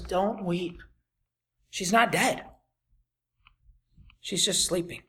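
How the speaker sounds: soft, quiet comfort; gentle reassurance. Acted. Jesus gently telling mourners she only sleeps, softly. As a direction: soothe the mourners.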